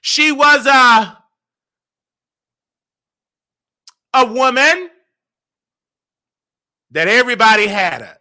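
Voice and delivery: loud, high, emphatic pulpit delivery; preaching. Acted. Explaining his praise like a sermon, preaching. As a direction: preach it at full force.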